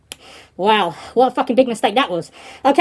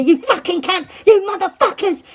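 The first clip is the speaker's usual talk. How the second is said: mock angry yell, higher pitched; retold abuse; 5 semitones higher than usual. Prisoner abuses the guards, retold angry yelling. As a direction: hurl the insults in an imitated rage. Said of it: "he's giving them abuse".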